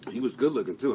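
ordinary talk

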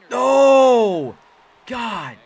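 high, loud drawn-out wail of protest; real anguished outburst; dismayed cry. Real, not acted. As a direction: cry out as it all goes wrong. Dismay as opponent gets a hit.

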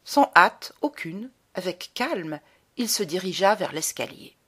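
ordinary delivery, nothing marked

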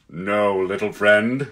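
Aslan, kindly. Acted. Lion correcting the proud little jackdaw, slow and kindly. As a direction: answer with patient gravity.

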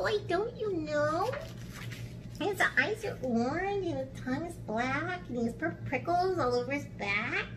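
high, soft mouse voice; knowing little mouse. Acted. Mouse telling snake about the monster, high and soft. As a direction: describe the monster in a small high voice.

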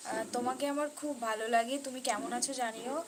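ordinary talk

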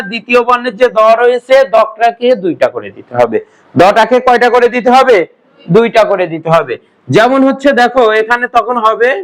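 ordinary talk

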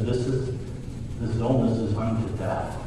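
usual speaking voice

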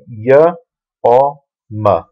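"Y, O, M" as separate sounds, a pause between each; teacher's spaced-out "Y, O, M"; sounding out letters. Shown as a demonstration. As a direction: sound out "Y, O, M" one by one for a learner.

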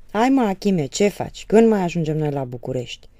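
loud impatient call; young man calling from the road, loud and impatient; shouting from the road. Acted. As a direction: holler to hurry him along. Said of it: "strigă flăcăul din drum".

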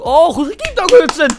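high, loud disbelieving yells; exasperated shouting. Real, not acted. Raging at the opponent's seventh goal, loud and high. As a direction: shout your outrage at the score. Outraged at opponent scoring more.

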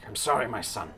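low, soft verdict from a solemn father; regretful kobold father. Acted. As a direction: pass sentence on your son quietly, with regret. Kobold father banishes his son.

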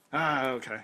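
ordinary talk, nothing marked

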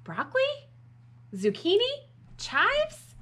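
mounting hopeful guesses; guessing aloud. Acted. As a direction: try out each idea, pitch stepping up. Max guesses the missing ingredient.

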